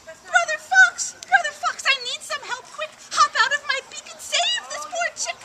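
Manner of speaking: high, loud desperate chick calling; pleading high cry; crying out for help. Acted. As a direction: shout for Brother Fox, frantic. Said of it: "cried out".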